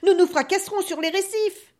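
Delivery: bellowing over the wind; thundering. A narrator's reading. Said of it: "tonna le capitaine".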